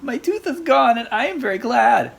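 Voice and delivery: loud, high gleeful shout; excited yell. A narrator's reading. Bunny telling mommy his tooth fell out, loud and glad. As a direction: shout it out with joy. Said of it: "he yelled".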